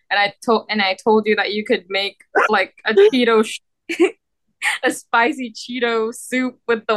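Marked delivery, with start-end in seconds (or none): none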